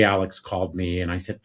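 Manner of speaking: ordinary delivery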